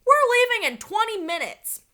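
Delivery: high, loud call. A narrator's reading. Dad warning the kids as they run off, loud call. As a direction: keep calling after them. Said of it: "their dad called".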